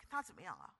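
normal talking voice

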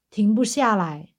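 normal talking voice

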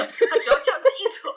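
high, loud laughing delivery. Real, not acted. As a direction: laugh through the words. 笑着讲爸爸撕海报. (边笑边高声说)

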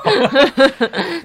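high laugh falling away; loud falling laugh. Real, not acted. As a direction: laugh out loud and let it fall. Host keeps laughing.

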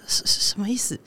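用气音轻声说，一脸无语; 压低声音，不可置信地嘀咕; exasperated whisper. Retold or imitated. 对挡路的人翻白眼. (对挡路的人无语地低声问)

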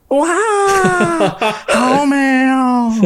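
高声拉长惊叹，很慢; 学妈妈惊喜地赞叹. Retold or imitated. 再学妈妈进房间惊叹. (高声慢慢模仿妈妈赞叹)

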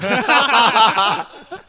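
loud rising laugh. Real, not acted. Recalling the coach's sigh to friends, loud laugh. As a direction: let the laugh burst out.

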